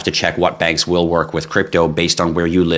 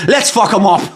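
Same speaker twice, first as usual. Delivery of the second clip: high, loud Irish battle cry; rally the lads in a hammed-up Irish accent; squealed Irish brawler voice; Irish boxer accent; 13 semitones higher, 5 dB louder than usual. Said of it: "Irish Boxer".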